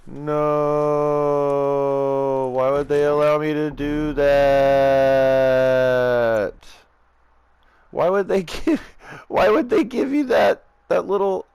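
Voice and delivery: loud, high disbelieving yell; outraged shout. Real, not acted. Outraged after dying in game.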